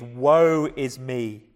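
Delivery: long, slow funeral wail in words; mourner's cry, drawn right out. Acted. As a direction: call out a slow lament. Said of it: "would be cried at the funeral".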